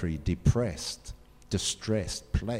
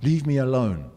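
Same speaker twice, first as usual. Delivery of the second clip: louder, pushed through clenched teeth; 3 semitones higher, 5 dB louder than usual. Shown as a demonstration. Preacher acts out a family member, teeth clenched. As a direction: spit it out, jaw tight. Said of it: "with your teeth clenched".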